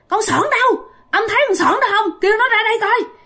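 high, strange ghostly demand; possessed girl voice. Retold or imitated. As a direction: speak as the ghost inside her, searching. Ghost questions the manager. (hồn ma nhập xác hỏi tìm Sỏn giọng cao lạ)

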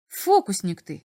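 ordinary delivery

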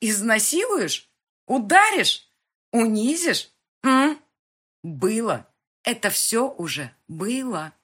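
high, loud bitter taunts; furious girl listing his past cruelties; defiant shouting. Acted. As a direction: throw each threat back at him. Girl defiantly taunts her abuser.

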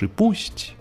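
plain reading voice

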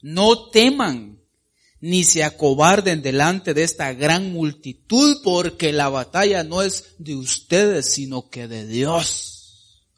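loud, slow proclamation; loud, slow prophetic promise fading at the end; prophetic reassurance. Acted. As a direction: declare it slowly, then settle. Reads God's message through the prophet.

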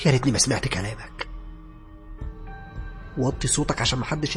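distressed quarrel. Acted. Woman in voice message blaming someone in distress. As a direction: blame him in panic.

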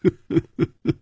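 breathy run of chuckles; old man's chuckle. A narrator's reading. Old man unable to help himself at teasing, breathy chuckle. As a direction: let out an amused, restrained laugh. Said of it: "果然忍不住说".

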